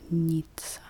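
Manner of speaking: ordinary talk, nothing marked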